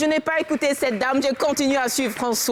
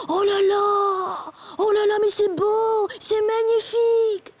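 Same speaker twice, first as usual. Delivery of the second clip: high, long-drawn gushing wonder; hammed-up awe, stretching each exclamation slowly; mock rapture; 8 semitones higher, slower than usual. Husband marvels at a little stream, mock rapture.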